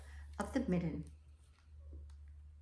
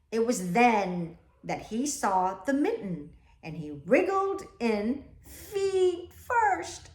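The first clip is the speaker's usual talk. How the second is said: lively, louder read-aloud; storybook reading; 8 dB louder than usual. A narrator's reading. Teacher reading the class a picture book, lively. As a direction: tell it with bounce.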